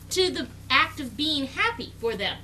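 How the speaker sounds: ordinary delivery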